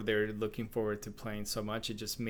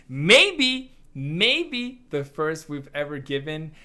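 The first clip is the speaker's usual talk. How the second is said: excited; 5 semitones higher, 8 dB louder, slower than usual. Real, not acted. Excited hedge on first A plus.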